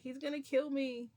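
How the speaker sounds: loud, high-pitched mock panic; retold panic. Storyteller relives facing the gun, panicked. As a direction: act out fearing for your life.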